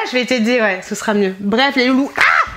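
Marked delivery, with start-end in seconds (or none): 2.2-2.6 high, loud yelp then laugh; startled cry. Real, not acted. Vlogger nearly falling on camera yelps then laughs. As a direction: caught off balance, then laughing.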